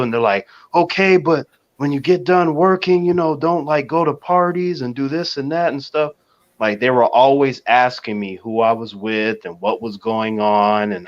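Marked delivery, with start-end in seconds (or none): 0.7-6.1 higher-pitched, lightly pleading parental voice; imitated worried parents. Parents warning their working child, imitated worry. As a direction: mimic a fretful parent's caution, pitched up.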